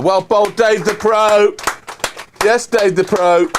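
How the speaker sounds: loud, high celebration; excited cheer. Real, not acted. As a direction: cheer the bowler loudly. Celebrating a wicket loudly.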